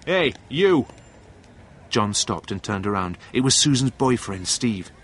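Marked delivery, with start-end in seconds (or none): 0.1-0.9 raised, higher-pitched call; shouted challenge. A narrator's reading. Boyfriend stops John in the street, shouting. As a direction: call out to stop someone. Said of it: "Suddenly, a voice shouted".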